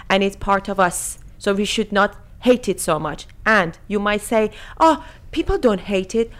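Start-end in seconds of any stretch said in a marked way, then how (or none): none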